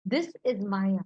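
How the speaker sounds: low, measured godlike pronouncement; Krishna's pronouncement. Retold or imitated. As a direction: deliver it low, as a calm revelation. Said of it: "Krishna looks at him and says".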